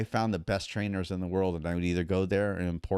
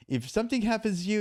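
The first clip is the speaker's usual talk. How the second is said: imitating Thai trainer, worried; 11 semitones higher, faster than usual. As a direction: warn him gently in the trainer's accent. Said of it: "He goes".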